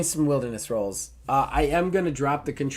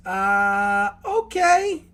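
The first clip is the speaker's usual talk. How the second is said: much higher, startled little okay; flustered squeak; 7 semitones higher, 4 dB louder, much slower than usual. Acted. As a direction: squeak it out, caught off guard. GM voices Lila flustered.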